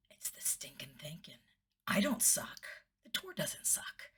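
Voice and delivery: very soft, breathy self-reassurance; hushed realization. Retold or imitated. Realizing it's stinking thinking, hushed self-reassurance. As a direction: say it to yourself, almost whispered.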